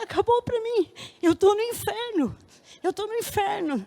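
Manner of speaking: high wailing cry of being in hell; despairing scream. Retold or imitated. Woman telling the church she screamed she was in hell. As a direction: wail as if trapped forever. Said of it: "Eu gritava lá".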